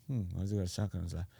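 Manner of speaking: quick, hushed aside to himself; muttered suspicious thought, acted out; private wondering. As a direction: think it aloud, soft and fast. Acting out his private wary thought.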